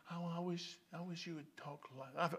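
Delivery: very soft imitation of gentle talkers; mock soft-spoken. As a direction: drop to a tiny, hushed voice, imitating. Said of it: "because they speak soft, I hear people".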